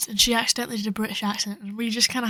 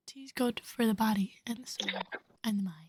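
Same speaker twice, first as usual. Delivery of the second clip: whisper; 11 dB quieter than usual. Pretend. Mock-wise advice about tea.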